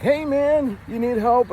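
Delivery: high-pitched friendly call-out; random helpful guy. Retold or imitated. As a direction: call out high, offering help to a stranger. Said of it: "some random guy is like".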